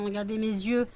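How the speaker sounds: barely able to keep going; exhausted. Real, not acted. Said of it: "j'ai qu'une envie, c'est dormir, ça se voit à ma tête".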